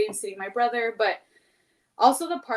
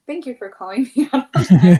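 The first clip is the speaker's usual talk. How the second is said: laughing through the words, much louder; 16 dB louder than usual. Real, not acted. Thanking the host while laughing, loud. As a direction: laugh it out, caught and amused.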